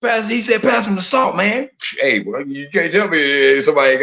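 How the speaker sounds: put-on stammer, then sing it out like an old Southern uncle. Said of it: "uncle down south talk like that".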